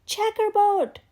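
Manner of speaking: loud, high shout of one word. Acted. A classmate shouts a pattern at Camilla, loud high shout. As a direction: bellow it across the room. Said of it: "Someone else shouted".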